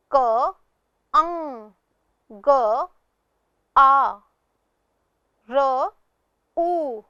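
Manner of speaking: क अं ग आ र उ, one sound at a time; spelled sound by sound. Shown as a demonstration. Teacher to pupils, sounding out कंगारू. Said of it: "आइए देखते हैं".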